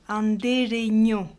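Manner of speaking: "andereño" with ñ said as French gn. Shown as a demonstration. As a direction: say "andereño" ending in -gno. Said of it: "ñ correspond au gne français". (« andereño » : ñ prononcé gn)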